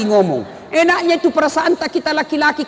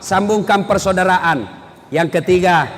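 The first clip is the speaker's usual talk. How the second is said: ordinary talk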